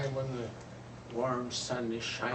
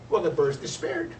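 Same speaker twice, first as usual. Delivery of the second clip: ordinary delivery, nothing marked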